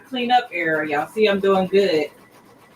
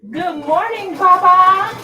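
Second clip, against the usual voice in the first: high, loud joyful hello; delighted real greeting; excited greeting; 11 semitones higher, 10 dB louder, slower than usual. Real, not acted. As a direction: beam it out, much higher and louder. Greeting a loved one.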